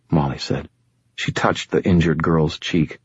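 usual speaking voice

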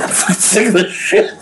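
high, exasperated retold outburst; fed up. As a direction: act out being sick of it. Retells own fed-up reaction to film.

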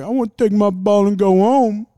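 high, loud whiny mock of a crybaby. Mocking a crying quarterback sulking on the sideline, loud mock whine. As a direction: wail it like a pretend sore loser. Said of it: "He was, like, crying on the sideline".

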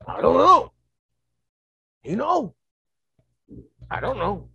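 loud, high, halting primitive voice; ape man with some language. Retold or imitated. Ape men puzzling over who they are, loud primitive voices. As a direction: blurt simple words like a baffled caveman. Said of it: "a different version of an ape man".

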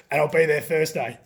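a hint of the coach signing off; Grant Russell. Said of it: "That's my best Grant Russell voice".

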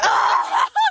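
high laugh. Real, not acted. Laughing at the story.

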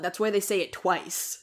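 ordinary delivery

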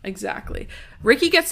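normal talking voice

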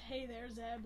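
Henry greets his washed-up hero, a touch sad; mildly sad greeting. Retold or imitated. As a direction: say it with a touch of sadness. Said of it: "like, all sad".

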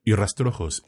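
ordinary delivery

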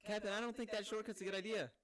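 high, loud mock objection; worried crewman. Crewman warning captain about the shortcut, put-on worry. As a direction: pipe up anxiously to the boss.

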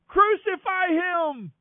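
very high, long, sustained yell. A narrator's reading. Crowd insists over Pilate's objection, roaring. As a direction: roar it out, stretching every syllable high. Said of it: "the crowd only roared the louder".

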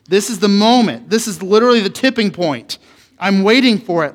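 loud, high, building declaration; mock-dramatic anticipation. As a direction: proclaim it like a huge turning point. Acting out his excited anticipation.

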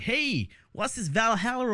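high, drawn-out mock American; American accent. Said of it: "I don't know why I'm American".

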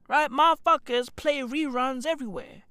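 usual speaking voice

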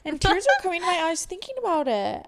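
tearing up with happy relief while telling listeners about dropping out; happy crying. Real, not acted. Tearing up while telling listeners she's happy she quit college. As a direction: voice lifting high, close to tears. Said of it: "Why am I crying right now?".

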